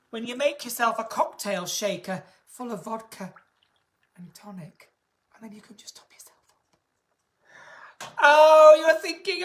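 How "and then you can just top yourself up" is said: fast hushed aside; whisper. Real, not acted. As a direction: slip the joke out in a whisper. Dark joke aside.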